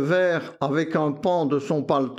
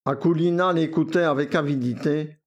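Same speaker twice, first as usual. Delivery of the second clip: plain reading voice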